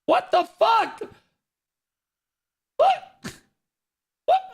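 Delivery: shocked streamer outburst; exasperated shout. Real, not acted. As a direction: cry out in disbelief. Streamer yelling at game graphics.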